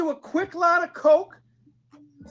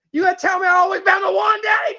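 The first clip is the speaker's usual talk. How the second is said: insistent demand; 3 semitones higher than usual. Acted. Demanding Daddy say she's the one, worked-up pleading. Repeat escalates toward screaming.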